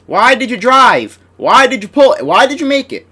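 exasperated yelling. Real, not acted. Gamer to his player, an exasperated loud rant. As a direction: berate the player for each move.

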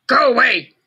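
loud grumpy shout; grouchy ladybug voice. A narrator's reading. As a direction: snap it at the other bug. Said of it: "shouted the grouchy ladybug".

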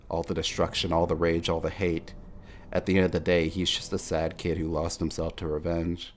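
normal talking voice

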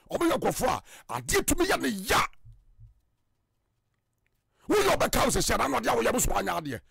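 ordinary talk, nothing marked

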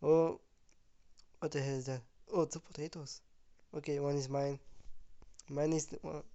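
ordinary talk, nothing marked

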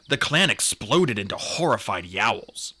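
plain reading voice

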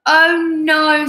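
loud, high dismay; exasperated bear. A narrator's reading. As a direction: groan as the sleepless bear. Sun wakes bear.

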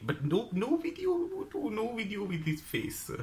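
put-on high, slow Chinese-accented warning. Stall owner forbidding videos, high Chinese accent. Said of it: "that strong Chinese accent".

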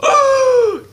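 sharp high gasp of alarm; shocked gasp. Acted. Chef Pee Pee sees Junior's cavity: shocked gasp.

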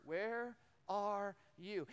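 "Where are you?" very slow, word by word; three separate words. Retold or imitated. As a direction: set apart each word of "Where are you?", without anger. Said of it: "God asks three words".

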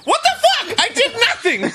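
outraged. Retold or imitated. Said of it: "He's not like".

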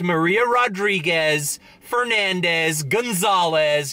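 Maria Rodriguez, Fernandez, Gonzalez said with American vowels and r; English pronunciation. Shown as a demonstration. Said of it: "Just say it in English".

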